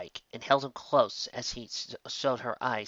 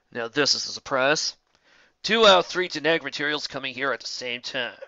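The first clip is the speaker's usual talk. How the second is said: casual villain; 2 semitones higher, 4 dB louder than usual. A narrator's reading. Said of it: "The red one spoke casually".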